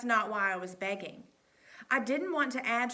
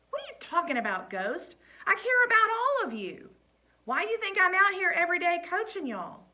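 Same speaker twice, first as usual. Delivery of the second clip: much higher, louder insistence; emphatic protest; 9 semitones higher, 7 dB louder than usual. A narrator's reading. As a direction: insist he cares. Coach answers protesting care.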